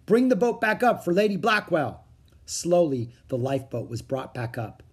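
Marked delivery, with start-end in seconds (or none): 0.0-2.0 raised, higher-pitched command; urgent official call; called order. A narrator's reading. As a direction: call an order across the deck. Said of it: "the uniformed man called".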